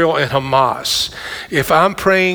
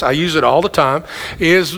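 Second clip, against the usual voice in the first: normal talking voice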